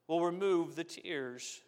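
normal talking voice

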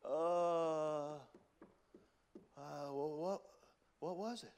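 mock blanking, long drawn-out uhs; hesitant, searching for a lost answer; stumped forgetting. Acts out forgetting the sermon points.

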